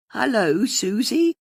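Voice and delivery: put-on high, reedy old caller's voice; a reedy older woman greeting the host on air. A narrator's reading. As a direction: pipe it up thin and reedy. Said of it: "a reedy voice said".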